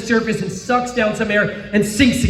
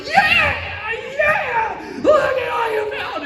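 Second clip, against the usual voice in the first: cheering husband; slower than usual. Retold or imitated. Husband cheering his wife on the shore, much higher. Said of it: "And I'm like".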